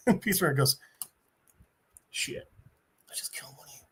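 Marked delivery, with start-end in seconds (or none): 2.1-3.8 barely audible muttered realization; acted-out guilty whisper; hushed dismay. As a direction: mutter it under your breath, as if horrified at your mistake. Hero realizes his mistake, muttering.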